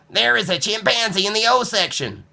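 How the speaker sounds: put-on high, earnest boy's voice; a worried little boy reporting a problem; high child voice; young Nicholas. A narrator's reading.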